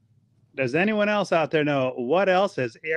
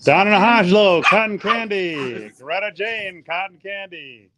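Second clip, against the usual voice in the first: drawn-out, swooping announcer call; game-show call-out; 7 dB louder, slower than usual. Pretend. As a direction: announce the winners, stretching each name. Announces audience answers like a host.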